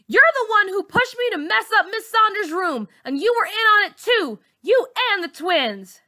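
very high, loud accusation; accusing shout. Acted. Girl accusing her bully in front of the teacher, loud and high. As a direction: expose her at last.

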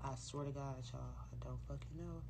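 soft, tearful, fading to a whisper; near whisper. Real, not acted. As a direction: barely get it out. Softly, still teary.

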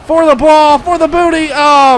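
loud, high rush for the ball; urgent high-pitched gamer cry; excited calling out. Real, not acted. Chasing the ball in game.

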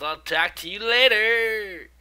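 called-out goodbye. Acted. Biker hurrying off on errands, drawn-out called goodbye. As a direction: sing out the goodbye, dragging the last word.

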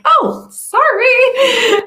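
very high, drawn-out little apology; hammed-up apology after being shushed; sheepish mock apology. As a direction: squeal a stretched-out sorry. Imitates herself after being shushed.

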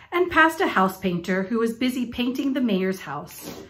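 ordinary delivery

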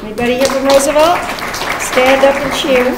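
loud, quick rally call to the audience; fast crowd shout-out. Real, not acted. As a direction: pump up the room. Calling crowd to cheer.

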